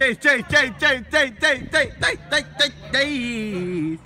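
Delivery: high, loud rhythmic chant; rapid high hype calls, last one drawn out; real hype shouting. Real, not acted. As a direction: yell the name over and over. Hyping up a mate by name.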